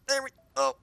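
high surprised yelp; surprised squeal. Real, not acted.

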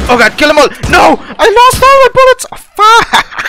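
screaming as the ammo runs out; real shouting. Real, not acted. Out of bullets mid-firefight.

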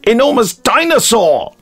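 high, put-on voice of a movie character; quoting the dubbed film; dubbed character line. The narrator retells the dubbed film, in a high put-on voice. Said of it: "And then they say".

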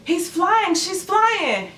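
high-pitched amazed onlookers; shocked and surprised. Acted. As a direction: act out a crowd in astonishment. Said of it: "shocked and surprised".

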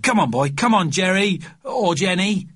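put-on high, coaxing child's call. A narrator's reading. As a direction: coax a lost pet out, calling. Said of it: "he cried".

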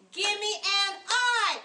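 loud, high cheerleader call. Shown as a demonstration. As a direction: shout it out like a pep squad. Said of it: "I wanna hear those cheerleader voices".

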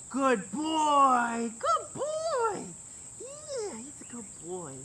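sweet high praise for a puppy; high soft dog praise. Real, not acted. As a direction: coo softly at the dog.

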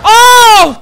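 loud high "oh" of shock; startled full-voice cry; shocked yell. Real, not acted. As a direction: cry out as if suddenly hit. Sudden shock during the game.

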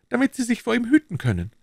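high, anxious servile voice; bow and scrape with every word; nervous, eager innkeeper; fawning innkeeper. A narrator's reading.

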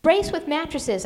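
mother crying out to Maggie to brace; urgent cry. A narrator's reading. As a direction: shout instructions over the storm. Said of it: "I cried to Maggie".

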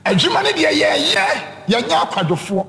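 preaching. Acted. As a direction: drive the point home to the congregation. Preaching the lesson.

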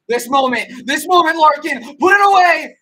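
high, loud cheering yell building; excited shout. Real, not acted. Fan screams at the TV for Larkin to score. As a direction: scream the player's name home. Cheering a goal chance live.

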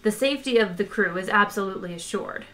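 ordinary delivery, nothing marked

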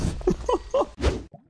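a squeaky airless panic laugh; high breathless laugh. Real, not acted. Laugh at five enemies.

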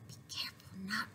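hushed whisper of a sneaking thief. A narrator's reading. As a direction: breathe it, don't voice it. Ladybug repeats the plan.

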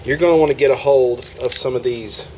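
ordinary talk

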